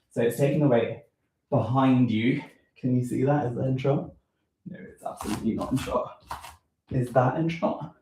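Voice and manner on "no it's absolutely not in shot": whispered aside. Real, not acted. Answers self while checking camera.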